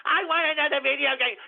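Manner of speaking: put-on whining like a big baby to his mommy; mock a grown man begging mommy; whiny man-child. Mocking trolls, whines like a spoiled child to mommy.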